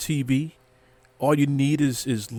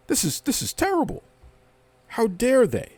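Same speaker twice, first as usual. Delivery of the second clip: high, sarcastic mock horror; mock outrage; 9 semitones higher than usual. Host mocking fans upset about networks, sarcastic mock horror. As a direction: gasp like a scandalized critic.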